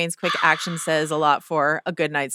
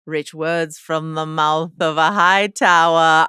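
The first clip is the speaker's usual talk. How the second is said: slow, weighty, declamatory quote; grand recitation; 4 dB louder, slower than usual. Acted. Podcast host quoting a listener's email, grand and slow. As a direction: read it out slowly and grandly.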